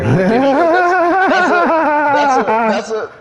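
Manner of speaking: loud high laugh over the words; cracking up, can't finish. Real, not acted. Cracking up mid-sentence.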